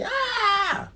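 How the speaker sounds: very high mock scream; squealed acted cry of distress; Buggy. Pirate captain hit by the sword attack, high mock scream. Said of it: "And Buggy's like".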